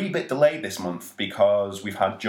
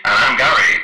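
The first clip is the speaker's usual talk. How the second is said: loud robotic monotone; 4 semitones higher, 13 dB louder than usual. Pretend. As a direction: speak like a corrupted robot. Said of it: "it was very robotic".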